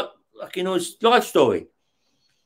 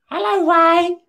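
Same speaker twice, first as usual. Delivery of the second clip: much higher, sing-song camp voice; camp greeting; 12 semitones higher, slower than usual. Retold or imitated. Terry greeting Ray at his cell door, high camp voice. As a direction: greet him like a flamboyant neighbour.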